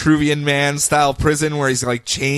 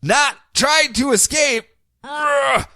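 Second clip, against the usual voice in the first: high, slow, strained effort voice; straining Superman; 10 semitones higher, much slower than usual. Retold or imitated. Dragging the entire ship with him, hammed-up straining voice. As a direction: force the words out between mock grunts. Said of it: "Superman goes".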